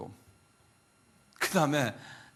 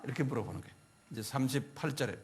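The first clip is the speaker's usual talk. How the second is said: usual speaking voice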